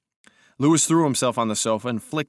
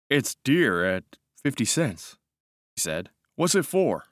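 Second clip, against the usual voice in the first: joking officer voice; 3 semitones higher than usual. Acted. Lewis joking.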